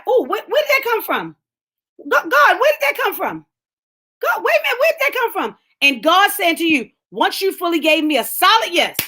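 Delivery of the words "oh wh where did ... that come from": higher, breathless bewilderment; amazed believer. Retold or imitated. Believer finding gifts in the field, amazed. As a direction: mimic stunned wonder.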